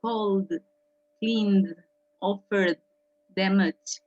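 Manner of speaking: "Cold, cleaned, offered, damaged" each ending in a voiced D. Shown as a demonstration. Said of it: "suena como D".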